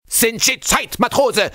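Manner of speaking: high, loud furious command; angry bark. Acted. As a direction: bark the order angrily at a sailor. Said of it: "the chief barked angrily".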